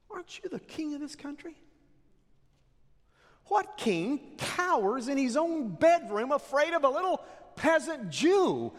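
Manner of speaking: high, mocking queen's scorn; taunting Jezebel. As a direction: goad him like a coward.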